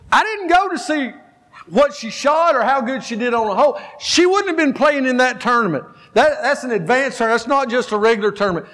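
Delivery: fired-up coach who won't be talked out of it; excited coach. Retold or imitated. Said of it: "pill that could calm down his just excitement and energy".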